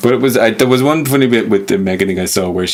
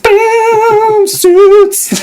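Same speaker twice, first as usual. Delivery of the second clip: high, loud triumphant shout; mock show sting; 6 dB louder than usual. Host closing a mock TV scene, loud triumphant shout. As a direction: belt it out like a TV show's big finish.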